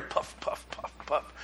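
very soft, breath-only little puffs; whispered puffs. Acted. Preacher sketching little clouds in the sky, whispered. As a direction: whisper each puff lightly, like dotting clouds.

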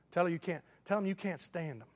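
usual speaking voice

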